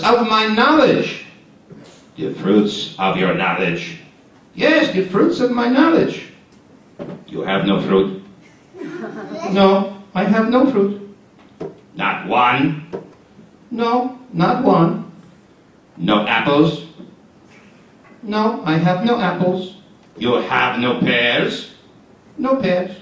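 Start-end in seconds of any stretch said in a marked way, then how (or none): 2.2-8.2 lower, drowsy grumble; low sleepy grumbling voice; sleepy grumpy friend. Acted. 12.0-12.9 lower, drowsy grumble; low sleepy grumbling voice; sleepy grumpy friend. Acted. Friend role. 16.0-16.9 lower, drowsy grumble; low sleepy grumbling voice; sleepy grumpy friend. Acted. Friend role. 20.2-21.7 lower, drowsy grumble; low sleepy grumbling voice; sleepy grumpy friend. Acted. Friend role.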